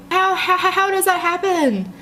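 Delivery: high, stunned repeated how; baffled and alarmed; shocked disbelief. Real, not acted.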